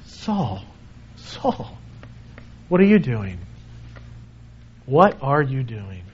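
quiet tender voice of the Lord; gentle pleading. Shown as a demonstration. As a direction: say it like a grieved friend calling softly. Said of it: "it's all how you read that with the tone".

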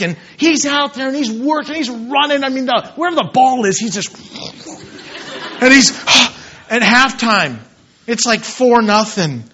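usual speaking voice

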